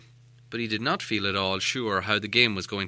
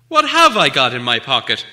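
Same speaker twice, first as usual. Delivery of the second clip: insistent, noticeably louder; 11 dB louder than usual. Acted. Bilbo repeats his riddle to Gollum, louder and insistent. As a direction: press the question firmly at him. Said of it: "he said louder".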